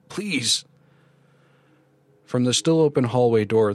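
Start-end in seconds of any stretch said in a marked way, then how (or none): 0.1-0.7 high, weak plea; frightened croak. A narrator's reading. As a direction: afraid and confused begging. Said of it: "I croaked, confused and afraid".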